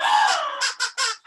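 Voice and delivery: a sudden high gasp of alarm; sharp gasp. Acted. Parrot remembering his friends, high alarmed gasp. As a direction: gasp as if struck by a thought.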